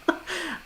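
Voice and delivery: high, soft laugh; short amused giggle, high and quiet; laughter. Real, not acted. Storyteller amused at the friend's hesitant request, soft laugh. Laughing while retelling the call.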